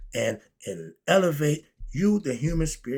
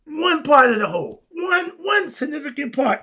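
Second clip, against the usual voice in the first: emphatic shout; 11 semitones higher, 7 dB louder than usual. Real, not acted. Emphatic teaching point.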